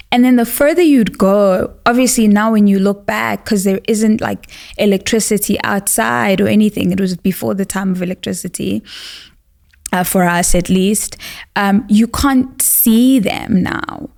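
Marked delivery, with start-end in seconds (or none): none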